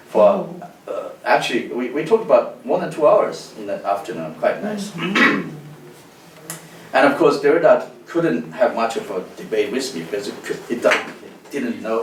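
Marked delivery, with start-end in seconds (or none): none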